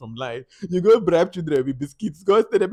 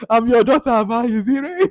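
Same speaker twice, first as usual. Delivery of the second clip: high, louder mock outrage; mock confrontation; 7 semitones higher, 5 dB louder than usual. Imitating the confrontation in the house, high and indignant. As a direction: throw the question up high and indignant.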